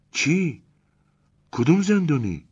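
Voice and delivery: high, sharp surprised question; surprised Elena. Acted. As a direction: Elena startled by mention of the prisoner, surprised.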